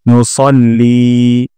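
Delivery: "নুসাল্লি" said as সল্লি with লি drawn out; slow, round the স and lengthen লি; heavy sa with long li. Shown as a demonstration. Teacher correcting the সাল্লি mistake. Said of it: "সাল্লির জায়গায় বলবো সল্লি".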